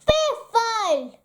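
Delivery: understated cross outburst; mild grumpy shout. A narrator's reading. Badger reacting to Rabbit's news, mildly shouting. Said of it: "shouted Grumpy Badger".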